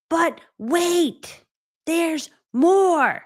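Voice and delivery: high, loud pitchman tease; infomercial hype. Pretend. Host hyping the price drop like a pitchman. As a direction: sell it like a TV ad host.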